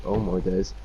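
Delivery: ordinary talk, nothing marked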